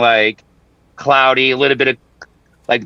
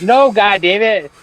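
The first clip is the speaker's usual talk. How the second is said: high, drawn-out mock echo; mimicked exasperation; 8 semitones higher, slower than usual. AI reading his outburst back, mock exasperated. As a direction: parrot the outburst slowly and high.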